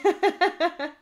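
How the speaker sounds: rire aigu, bref; éclate de rire; éclat de rire. Real, not acted. Rit d'une réponse. (rire amusé)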